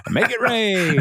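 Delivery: villain unleashing the cologne on the crowd, gleeful and high; villain command. Retold or imitated. Dennis ordering cologne spray.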